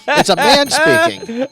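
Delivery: loud, high, drawn-out mock proclamation; hammed-up high holler, every word stretched; mock indignant announcement. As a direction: belt it out slowly as a joke. Joking after interrupting a co-host.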